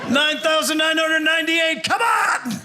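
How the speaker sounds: high, straining mock shout of a rep count; screaming. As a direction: force it out like the last reps. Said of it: "screaming for a spot".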